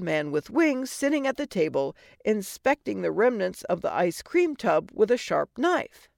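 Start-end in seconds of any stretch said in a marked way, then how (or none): none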